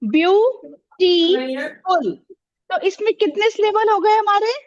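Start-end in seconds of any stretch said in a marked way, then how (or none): 0.1-2.1 "ब्यूटीफुल" stretched into ब्यू... टी... फुल; syllable by syllable. Shown as a demonstration. Teacher shows which sounds go together, "ब्यूटीफुल" in three slow syllables. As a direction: say "ब्यूटीफुल" very slowly, pausing after each syllable. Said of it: "इसलिए हमने इसे एक साथ लिया".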